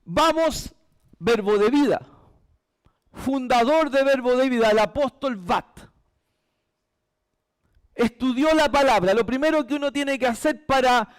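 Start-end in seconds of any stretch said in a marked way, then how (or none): none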